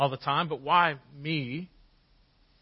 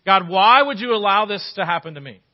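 loud, pleading cry upward; anguished cry to God; 5 semitones higher, 10 dB louder than usual. Retold or imitated. Believer crying out to God in pain, loud anguished plea. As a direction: throw the question at God in anguish.

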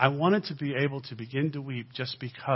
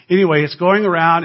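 loud preaching emphasis; 6 semitones higher, 12 dB louder than usual. Real, not acted.

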